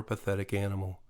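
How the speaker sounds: ordinary delivery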